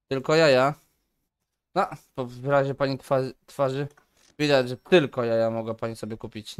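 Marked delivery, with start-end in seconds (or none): 0.1-0.7 mock disbelief. Remarking on customer's purchase.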